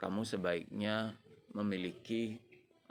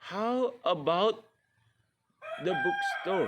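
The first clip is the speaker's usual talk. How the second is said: slow, high, bright suggestion; drawn-out, higher friendly suggestion; role-play suggestion; 9 semitones higher, 5 dB louder, slower than usual. Acted. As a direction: sell the bookstore idea to a friend, slowly. Role-play dialogue suggesting a place.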